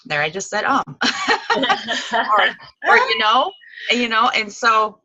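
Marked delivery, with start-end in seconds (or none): 0.9-2.1 short embarrassed laugh at herself; quick self-conscious chuckle; light laugh. Real, not acted. Laughs at own um.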